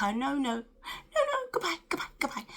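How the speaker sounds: high, squealy no no goodbye; flustered hammed-up brush-off; mock squealing goodbye. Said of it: "George was like".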